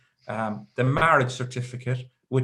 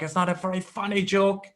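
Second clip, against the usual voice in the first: higher-pitched retort, imitating an annoyed wife; lightly acted indignation, pitched up; mock angry wife; 7 semitones higher than usual. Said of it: "very angry with me".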